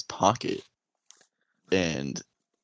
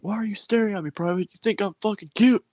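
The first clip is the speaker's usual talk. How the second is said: much higher, mock drill sergeant bark; 13 semitones higher, faster than usual. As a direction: yell at the staring recruit. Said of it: "He's like".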